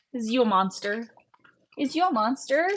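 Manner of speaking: sing-song pet-parent voice. Real, not acted. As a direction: coo it playfully to a little one. Baby talk to a little one.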